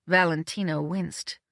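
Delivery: ordinary delivery, nothing marked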